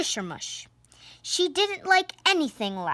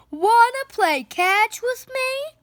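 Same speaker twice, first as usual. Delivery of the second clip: much higher, louder eager call; 11 semitones higher, 5 dB louder than usual. Acted. Barney asking the neighbour to play catch, high loud call. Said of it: "called Barney Loud".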